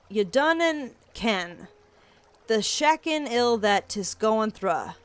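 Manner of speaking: slow, heavy Scottish brogue; thick Scottish accent. Acted. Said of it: "The thickness of his accent".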